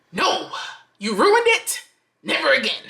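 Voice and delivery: high-pitched, exasperated rejection; mock-outraged telling off, pitch jumping up; indignant outburst. Woman telling off the guy who played her, indignant.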